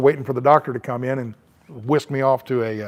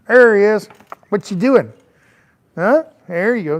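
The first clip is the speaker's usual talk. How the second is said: talking to a puppy; 6 semitones higher than usual. Real, not acted. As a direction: coo at the puppy, pitched up. Greeting the puppy.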